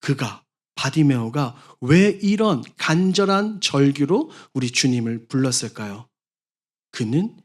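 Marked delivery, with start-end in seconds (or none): none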